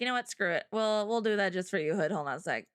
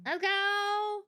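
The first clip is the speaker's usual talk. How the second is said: very high, stretched-out cheer; playful cheer; 11 semitones higher than usual. Pretend. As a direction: squeal a little hooray. Cheering the first arrival.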